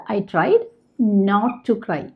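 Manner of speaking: drawn-out, word by word; slow model sentence. Shown as a demonstration. Teacher showing learners the English sentence, very slow. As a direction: dictate it slowly to the class. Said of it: "teacher models sentence".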